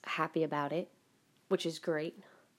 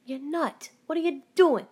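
higher and louder, half laughing; exasperated outcry; 8 semitones higher, 4 dB louder than usual. Retold or imitated. Scolding the friend who set off the Echo. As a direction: call him out in disbelief. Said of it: "I'm like".

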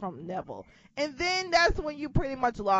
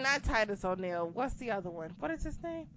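ordinary talk

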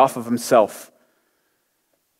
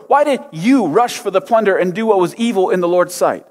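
raised, pressing rebuke from Samuel; stern prophet rebuke; 7 semitones higher than usual. Acted. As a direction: scold the king with rising force.

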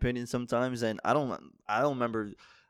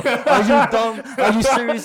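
high, loud furious yelling; angry shout; 11 semitones higher, 11 dB louder, slower than usual. Acted. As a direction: yell it in outrage. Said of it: "I cut a promo".

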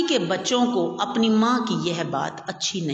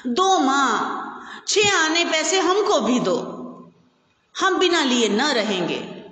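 put-on higher, stubborn child's demand; a boy throwing a tantrum at his mother; mild petulant whine, higher; sulky demand; 7 semitones higher than usual. A narrator's reading. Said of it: "माँ से बिगड़ कर बोला".

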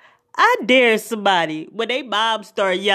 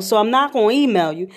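ordinary talk

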